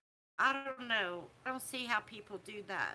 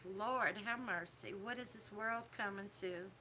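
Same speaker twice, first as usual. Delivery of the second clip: softer, lower plea to the Lord; dismayed aside; 3 semitones lower, 8 dB quieter than usual. Retold or imitated. Vlogger reacting to swearing online, dismayed aside. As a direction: say it under your voice, as a dismayed aside.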